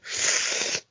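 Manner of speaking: sudden mock gasp of realization; sharp gasp. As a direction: gasp as if it just clicked. Gasp of realization before quote.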